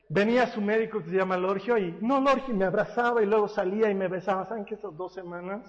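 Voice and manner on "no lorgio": usual speaking voice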